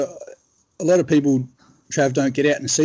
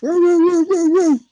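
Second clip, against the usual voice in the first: high hound howls, woo woo woo; bay like hunting dogs closing in on a deer; imitating baying dogs; 5 dB louder than usual. Telling a mate about the hunt, imitating the high baying dogs. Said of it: "you hear the dogs".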